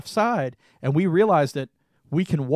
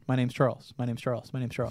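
softer muttered repetition, fading each time; rehearsing under his breath; 3 semitones lower, 7 dB quieter, faster than usual. Retold or imitated. Nervous fan rehearsing his intro before meeting the actress, softly muttered. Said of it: "in my head, I'm saying this over and over again".

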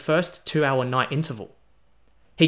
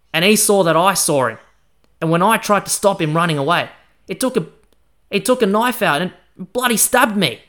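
louder, higher, worked-up bloke's retelling; Liang telling of the stabbing; 3 semitones higher, 7 dB louder than usual. Acted. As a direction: tell it hot and indignant.

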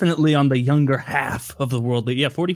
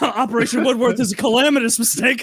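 high, urgent mock radio report; alarmed handler; 7 semitones higher, 3 dB louder than usual. Handlers aborting Operation Woodworth, high mock alarm. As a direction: call off the mission in a pretend panic. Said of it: "And they're like".

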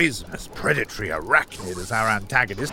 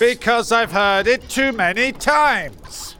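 slow, high, drawn-out complaint; exasperated; 9 semitones higher, 4 dB louder, slower than usual. Acted. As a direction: whine it, fed up.

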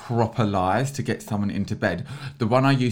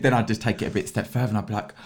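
ordinary talk, nothing marked

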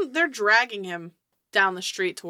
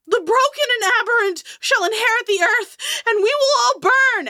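high, loud prophetic scream; last scream; 8 dB louder than usual. Acted. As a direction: scream it out like a doomed madman. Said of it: "the last thing that he screams".

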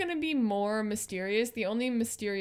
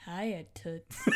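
gangster's moll; 5 semitones lower, 7 dB quieter than usual. Acted. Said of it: "Wait, no, he's British".

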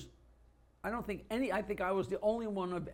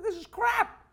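high, louder indignant whine of complaint; mock kid protest; 9 semitones higher, 8 dB louder than usual. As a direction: play up the outrage, pitched well up. Retells his childhood protest.

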